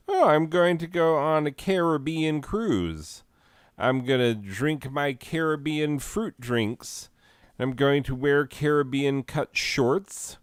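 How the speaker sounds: mocking mispronouncer. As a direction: parody someone proudly saying it wrong. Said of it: "you say Caribbean, so you enjoy being wrong".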